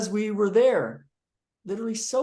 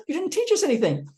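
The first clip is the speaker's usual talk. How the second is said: cleaned-up complaint; 8 semitones higher than usual. Retold or imitated. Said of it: "I didn't say damn".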